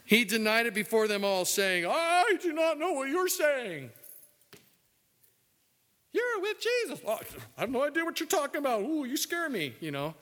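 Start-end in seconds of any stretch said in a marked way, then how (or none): 1.9-3.9 high, hammed-up panicky denial; Peter denying. 6.1-7.0 put-on very high falsetto girl's taunt; accusing girl in mock falsetto; servant girl voice. 7.0-9.7 high and fast, mock-frightened; scared Peter.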